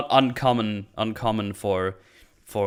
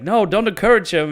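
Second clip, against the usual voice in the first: mock scold, higher and louder; scolding wife; 10 semitones higher, 7 dB louder than usual. Wife telling her husband to stop encouraging the boy, mock scold. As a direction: snap it at the husband like a fed-up mom.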